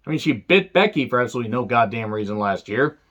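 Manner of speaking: ordinary talk, nothing marked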